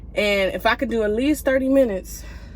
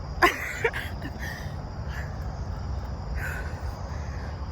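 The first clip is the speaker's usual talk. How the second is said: long airy wheezing laugh; breathless laugh; 13 semitones higher, 7 dB quieter than usual. Real, not acted. Worn-out laugh at herself after the run. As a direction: laugh it out, exhausted.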